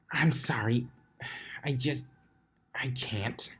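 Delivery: soft, broken-off whispered apology; defeated whisper. Acted. Twilight apologizing to Applejack, whispered and defeated. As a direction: barely get the words out.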